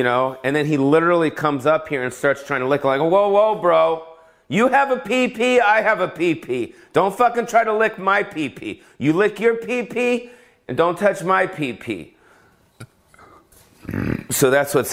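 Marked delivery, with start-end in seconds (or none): 3.0-12.1 higher, indignant bro voice pushing the dog off; lightly hammed-up telling off; half-joking outrage at a licking dog; mock scolding dog. Scolding his dog in the shower.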